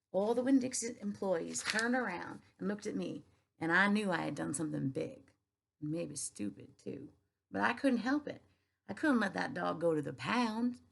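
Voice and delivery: a hint of a Southern girl telling her tale; Southern accent. Teacher reads aloud to her class in a light Southern accent. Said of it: "So I have to get my Southern accent on".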